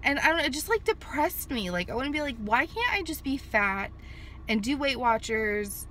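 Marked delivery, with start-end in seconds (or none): none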